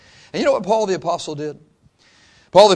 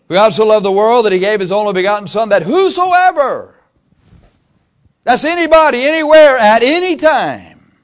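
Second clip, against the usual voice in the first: much higher, louder, slowing on whosoever; full-force preaching; 7 semitones higher, 7 dB louder than usual. Acted. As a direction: ring it out from the pulpit. Preaching a John 3:16 climax.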